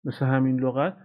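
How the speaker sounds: usual speaking voice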